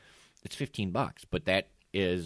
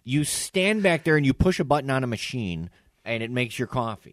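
high, loud comic outrage; indignant rant; 8 semitones higher, 9 dB louder than usual. Real, not acted. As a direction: rant in raised, incredulous exasperation. Indignant rant about barista title.

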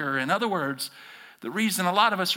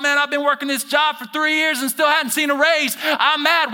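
loud, higher, aggrieved rant; mock angry employee; 9 semitones higher, 6 dB louder than usual. Pastor voicing a worker angry at the boss, loud rant. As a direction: complain about the raise, fed up. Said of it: "You're mad at your boss".

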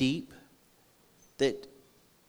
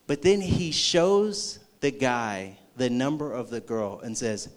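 ordinary talk

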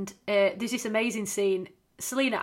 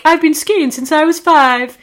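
higher, loud, toffee-nosed boast; posh show-off; 7 semitones higher, 12 dB louder than usual. Retold or imitated. Posh guests bragging to Bridget, haughty voice. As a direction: lord it over the newcomer. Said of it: "and they're all like".

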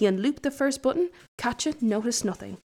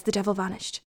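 usual speaking voice

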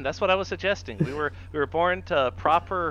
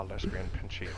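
low mutter; 10 semitones lower, 11 dB quieter than usual. Retold or imitated. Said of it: "mock quote".

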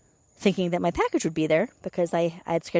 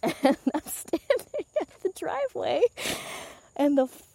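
high voice through real laughter; laughing; 9 semitones higher than usual. Real, not acted. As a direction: laugh while telling it. Laughing at own retold excitement.

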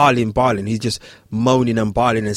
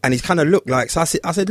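ordinary talk, nothing marked

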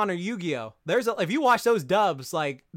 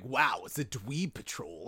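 Kaiba impression; 6 semitones lower, 6 dB quieter, slower than usual. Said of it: "it's just like".

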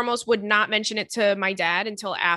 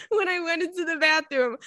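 laughing; 6 semitones higher than usual. Real, not acted. Laughing while reading.

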